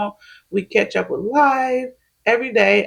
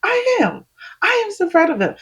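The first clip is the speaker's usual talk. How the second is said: gushing proud reply; 10 semitones higher than usual. Retold or imitated. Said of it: "I was like".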